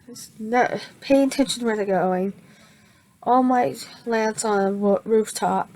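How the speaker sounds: ordinary talk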